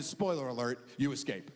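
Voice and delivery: ordinary talk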